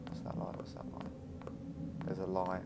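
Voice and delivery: soft, hushed and tense; scared whisper. Real, not acted. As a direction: whisper as if something might hear. Scared peering in dark.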